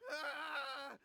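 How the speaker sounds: high frightened shout; a yelp of giving in; panicked scream. Acted. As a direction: break with a sharp cry of fear. Boy cracks under threat.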